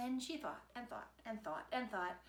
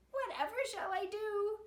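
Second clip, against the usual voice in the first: high, whiny brother; brother's high wail; 12 semitones higher, 4 dB louder than usual. A narrator's reading. Brother left alone on the bank, high and whiny. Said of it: "cried Piggy's brother".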